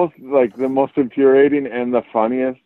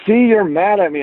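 high-pitched imitation of a kid; accusing young child; 10 semitones higher than usual. Four-year-old accusing his dad, imitated child voice. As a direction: mimic a child pitched well up. Retold quote of young son.

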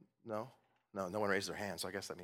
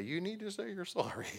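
imitating whimpering child; 5 semitones higher, 7 dB quieter than usual. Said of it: "he begins to whimper and say".

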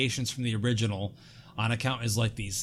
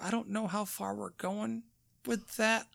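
usual speaking voice

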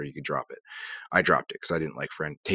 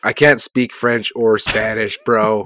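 louder and higher, slow protest; 5 semitones higher, 11 dB louder, slower than usual. Real, not acted. Friend blurts out to his cohost that he speaks no languages, loud and slow. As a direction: throw it out loud, half laughing at yourself. Playful outburst about languages.

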